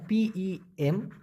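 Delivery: slow, soft letter-by-letter spelling of পম. Shown as a demonstration. Teacher spelling পম for students, slowly and softly. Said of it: "পম".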